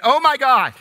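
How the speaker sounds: high, loud panicked cry. Acted. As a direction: yell it as the bear is about to strike. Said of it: "the atheist cries out".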